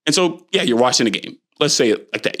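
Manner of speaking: ordinary talk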